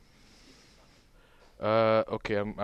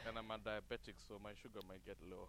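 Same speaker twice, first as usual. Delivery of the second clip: low-volume, quick and far from the mic; speaking softly, trailing away fast; a little faint; 18 dB quieter, faster than usual. Real, not acted. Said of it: "the volume sounds a little low to me on that end".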